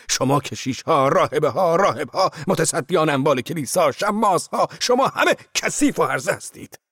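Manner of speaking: rising furious shout at the monks; furious tirade. Acted. Zorba curses the monks.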